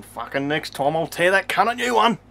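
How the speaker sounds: loud inner tough-guy rant, building; mock defiant rage. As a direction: build to a blustering mock shout. Imagined defiance while obeying the cops.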